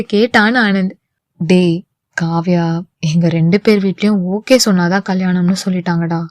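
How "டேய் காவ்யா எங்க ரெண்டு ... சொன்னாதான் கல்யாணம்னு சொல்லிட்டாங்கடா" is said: weary voice. A narrator's reading. Karthik tells his friend about the parents' consent, wearily. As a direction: let the voice sink, tired and deflated. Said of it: "சோர்ந்த குரலில் கூறினான் கார்த்திக்".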